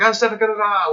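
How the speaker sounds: high, loud mock speaking in tongues; hammed-up church babble. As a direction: babble like someone drunk in the Spirit.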